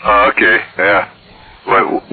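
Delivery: normal talking voice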